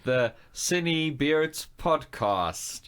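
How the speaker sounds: drawn out slowly like a big reveal; slow grand intro. Pretend. Host to cohosts, slow grand podcast intro. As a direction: stretch the show name out grandly. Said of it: "this is gonna be so slick".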